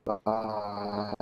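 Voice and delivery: flat robotic goodbye. Real, not acted. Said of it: "You sound like a robot".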